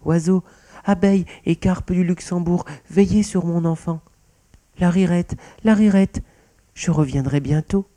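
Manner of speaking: high, loud plea to everyone around; calling out. A narrator's reading. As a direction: call out urgently as you are carried off. Mouse calls out while carried away. (appel lancé au loin)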